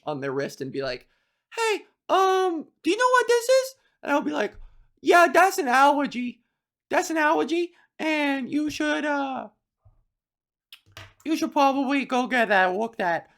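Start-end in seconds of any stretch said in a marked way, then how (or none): 1.5-3.8 put-on very high-pitched child's voice; a schoolkid asking in a high, squeaky pitch; put-on little kid voice. 5.0-13.3 high put-on child's voice; high-pitched little know-it-all; kid playing doctor. Plays young self giving medical advice.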